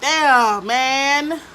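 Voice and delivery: loud, high, drawn-out lament; retold upset wail. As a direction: act out wailing in dismay. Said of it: "I got upset and started crying".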